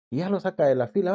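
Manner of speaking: usual speaking voice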